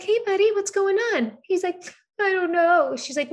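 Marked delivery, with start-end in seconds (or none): 0.0-1.4 put-on high, singsong kid-directed voice; teacher coaxing child. 2.2-3.0 put-on high little-boy voice; small child answering. Voices young son answering.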